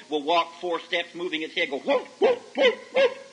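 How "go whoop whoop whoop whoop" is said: high squeaky whoop whoop of a toy dog. Retold or imitated. Preacher mimics the toy dog for the youth, high squeaky whoops. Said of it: "the dog will".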